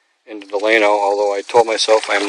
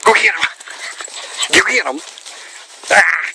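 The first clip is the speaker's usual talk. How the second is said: excited urging; 7 semitones higher than usual. Real, not acted. Urging the dog at the lake.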